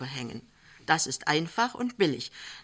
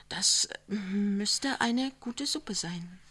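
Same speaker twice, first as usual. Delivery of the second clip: slow hushed whisper; 4 semitones higher, much slower than usual. A narrator's reading. Lämmchen defending her watery soup in a slow whisper. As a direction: whisper it, unsure of yourself.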